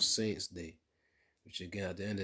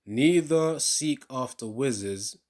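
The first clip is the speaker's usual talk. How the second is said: slow, loud preaching read; scripture reading; 2 semitones higher, 12 dB louder, slower than usual. Acted. Preacher reading Leviticus to his viewers, slow loud proclamation. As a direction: proclaim each verse slowly like a pulpit reading.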